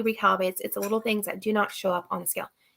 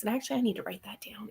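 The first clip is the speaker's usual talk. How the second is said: a hurried whispered note to self; fast whisper; 9 dB quieter, faster than usual. Real, not acted. Reminding herself to write it in her journal, fast whispered aside. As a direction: whisper to yourself, quickly.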